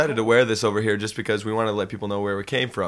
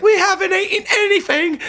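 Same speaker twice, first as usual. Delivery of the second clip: put-on much higher, crying whine of a starving fan girl; imitating hungry fans; 4 dB louder than usual. Mocking fan girls waiting in line all day, whiny crying voice. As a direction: wail it like a famished fan girl. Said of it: "they're like".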